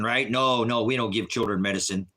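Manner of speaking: ordinary delivery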